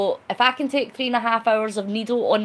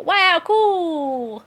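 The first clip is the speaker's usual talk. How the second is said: put-on Charlene's accent, higher and louder, a bit overdone; imitating Charlene's accent; 8 semitones higher, 5 dB louder than usual. Reading Charlene's chat comment aloud in Charlene's accent. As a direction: mimic Charlene's accent with a high wow. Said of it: "I tried to do your accent".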